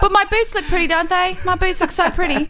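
very high, giggly preening voice; mock high proud giggle; Phoebe. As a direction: coo over the new boots, half laughing. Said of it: "She's like".

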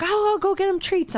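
high-pitched imitation of a helpful attendant; eager offer. Parking attendant offering to fetch dog treats, eager high imitation.

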